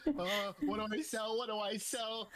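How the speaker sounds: high, loud self-imitation; hammed-up worried self-quote; mock fretting. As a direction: play at being at a loss. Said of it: "I'm like".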